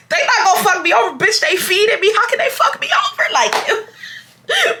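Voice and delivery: storyteller laughing at herself over free candy, high and giggly. Retold or imitated. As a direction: crack up while quoting yourself. Laughing at her own greed.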